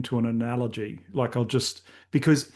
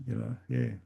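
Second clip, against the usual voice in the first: usual speaking voice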